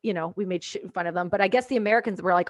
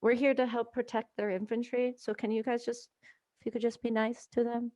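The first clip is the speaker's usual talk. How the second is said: much softer, sweetly pleading voice; mock polite plea; 6 dB quieter than usual. Gunner asking the Americans to be nice, soft mock politeness. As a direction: ask them nicely, hushed. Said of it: "and I'm like".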